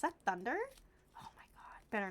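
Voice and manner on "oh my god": hushed whisper. Real, not acted. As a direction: whisper it in alarm. Hearing thunder.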